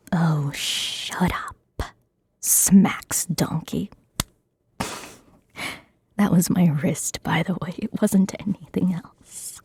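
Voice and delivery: ASMR whisper. Acted. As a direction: whisper every word close to the mic. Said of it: "my first time doing A S M R".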